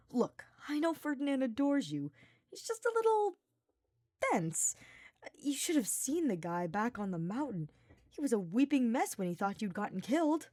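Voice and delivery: playful paladin reassuring his friend; Sylvain, easygoing and teasing. Acted. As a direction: tease him like an old friend. Sylvain voiced in a read story.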